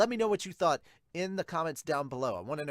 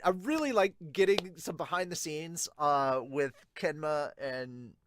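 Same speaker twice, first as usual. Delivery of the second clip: ordinary talk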